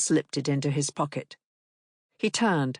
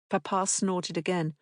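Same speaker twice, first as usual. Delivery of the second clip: ordinary delivery, nothing marked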